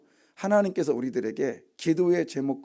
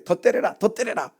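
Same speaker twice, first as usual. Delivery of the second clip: higher-pitched goading repeated like a cheer; mock egging on; 6 semitones higher than usual. Preacher mimics a bystander urging more blows, goading. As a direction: goad them on from the sidelines.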